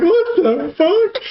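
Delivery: high, slow, laughing what the fuck; laughing disbelief. Retold or imitated. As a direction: crack up while acting the shock. No toilet paper at uncle's.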